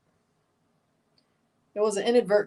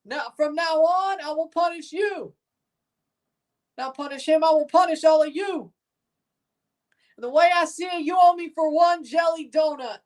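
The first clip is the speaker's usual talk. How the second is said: drill sergeant; 8 semitones higher than usual. Pretend. Drill sergeant announcing punishment to the platoon, high and stern. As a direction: hand down the punishment to the whole platoon.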